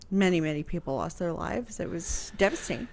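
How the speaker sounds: ordinary talk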